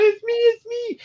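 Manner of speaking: very high, pleading squeal; desperate cat. Retold or imitated. Cat pleading high with crew who can't see him. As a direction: squeak it up high, begging to be seen. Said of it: "the cat's going".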